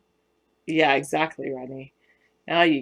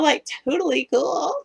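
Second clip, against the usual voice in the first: put-on higher, light Valley girl accent; 10 semitones higher than usual. Showing chat how Valley girls talk, in a light Valley girl accent. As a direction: toss it off like a California Valley girl. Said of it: "Or a Valley girl".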